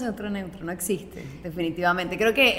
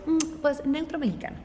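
mock neutral Mexican; 6 semitones higher, 3 dB quieter than usual. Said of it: "Y yo".

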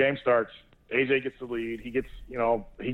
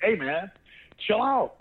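retold shout; 8 semitones higher, slower than usual. Dad breaking up kids' fight.

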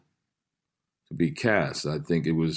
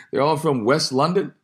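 mock London accent; 8 semitones higher than usual. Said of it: "British actors doing Antiquity".

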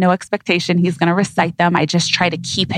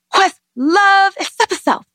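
put-on toddler voice; 14 semitones higher than usual. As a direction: squeak it out like an excited toddler. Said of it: "he'll be like".

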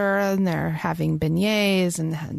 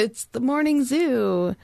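morning zoo DJ; 5 semitones higher, slower than usual. Pretend. Mock radio show intro.

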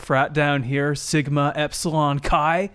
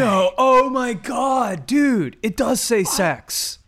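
excited shout; 8 semitones higher, much slower than usual. Acted. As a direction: yell it out in amazed disbelief. Bros discover shirt says sex.

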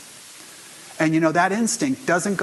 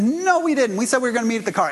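exasperated protest; 8 semitones higher, faster than usual. Retold or imitated. Son arguing about where they agreed to meet, exasperated high protest. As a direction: insist hard that she has it wrong. Son argues back with mother.

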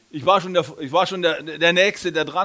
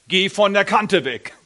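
slow, louder warning; 4 semitones higher, 6 dB louder than usual. Retold or imitated. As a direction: call slowly, as a warning from inside her head. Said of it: "sie hört die Stimme im Kopf". (langsame, laute Warnung im Kopf)

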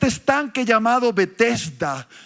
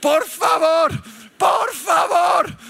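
exasperated plea; 5 semitones higher, slower than usual. Real, not acted. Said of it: "exasperated plea to audience".

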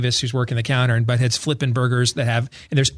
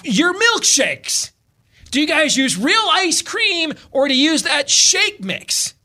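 exasperated customer; 12 semitones higher, slower than usual. Retold or imitated. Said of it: "the guy says".

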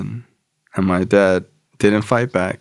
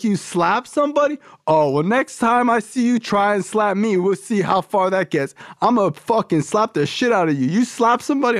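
much higher put-on voice of a blunt sponsor; tough sponsor voice; 12 semitones higher than usual. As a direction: bark out the tough-love lecture. Sponsor threatens.

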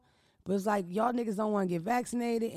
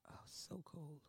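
whispered; 6 semitones lower, 22 dB quieter, slower than usual. Real, not acted. Reacting to something cold.

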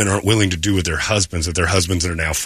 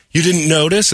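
higher, louder hurt disbelief; hammed-up woman hurt he missed it; offended coworker; 8 semitones higher, 4 dB louder than usual. Coworker scolds him for missing her hair, mock hurt.

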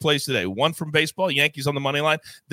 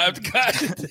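normal talking voice

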